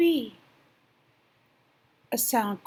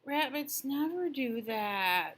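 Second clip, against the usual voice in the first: sleepy rabbit; 3 semitones higher, 6 dB quieter, slower than usual. Acted. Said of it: "said the sleepy little rabbit".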